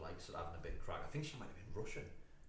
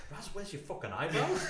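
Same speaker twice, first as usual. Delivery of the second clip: high, louder, laughing through the question; laughing quote; 11 semitones higher, 5 dB louder than usual. Retold or imitated. Laughing as he asks Raz about his eyebrows. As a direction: crack up while asking it.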